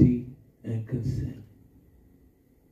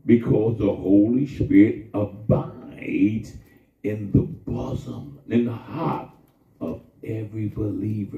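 drawn out, every word weighed; slow it right down and press it home; slow emphatic repeat; 3 semitones lower than usual. Shown as a demonstration. Preacher repeats the line slowly for the church. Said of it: "Did you hear what I just said?".

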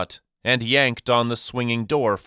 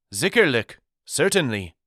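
put-on a raised, friendly answer in a thick European accent; 4 semitones higher than usual. The deliveryman confirms his name to Daddy in a thick European accent. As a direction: give the German word and its English in a heavy European accent. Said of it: "he inquired with a thick European accent".

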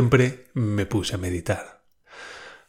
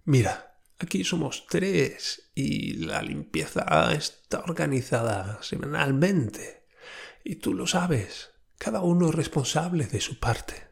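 voz quebradiza, lenta y más baja; hilo de voz quebradiza; 4 semitones higher, 5 dB quieter than usual. Retold or imitated. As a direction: apenas un hilo de voz que se quiebra. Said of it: "en apenas un hilo de voz quebradiza". (hablar bajito y lento con la voz quebrada)

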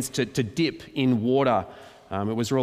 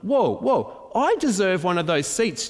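indignant Peter; 7 semitones higher than usual. Retold or imitated. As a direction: play Peter demanding his seat. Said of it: "he's going".

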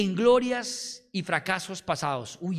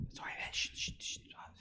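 whispered shh to calm someone; shushing whisper; 14 dB quieter than usual. Retold or imitated. Pastor hushes an agitated man in a whisper. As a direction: hush them gently in a whisper. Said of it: "así, y yo".

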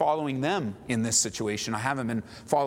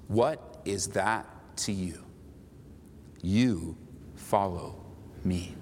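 very slow, low, spaced-out words; slow and solemn, dropping low; grave, measured command; 4 semitones lower, 3 dB quieter, much slower than usual. Acted. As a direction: let each word land separately, with authority. Jesus answering Peter, read out.

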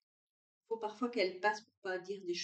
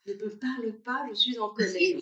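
mimicking the son; 3 semitones higher, 8 dB louder than usual. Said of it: "il le dit souvent aussi".

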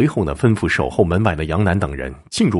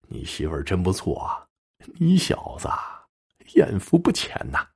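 gloating amusement; 5 dB quieter than usual. A narrator's reading. Yang Jin taunts the woman's husband, gloating and amused. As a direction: taunt him with a chuckle, drawing it out. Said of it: "对着女子丈夫笑道".

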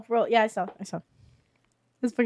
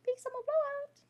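high cheery salesman voice; 12 semitones higher, 14 dB quieter than usual. Acted. Guard catchphrase.

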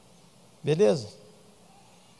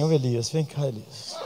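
soft, tender calling of the name; lowered voice; 7 dB quieter than usual. Retold or imitated. Pastor playing God softly calling depressed Elijah, tender and quiet. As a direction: coax him gently like a friend. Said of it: "E eu baixei a voz".

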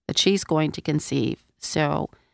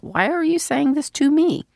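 surprised; 9 semitones higher than usual. Retold or imitated. Mary questions the angel.

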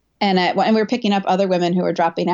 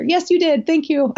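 grateful reassurance; 9 semitones higher than usual. Retold or imitated. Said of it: "I had to tell him after".

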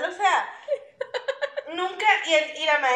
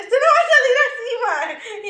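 mock angry mom; 9 semitones higher, 5 dB louder than usual. Mother scolding her son over his one-legged pants, mock angry. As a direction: play the mother scolding, half-laughing. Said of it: "mi mamá se enojaba y decía".

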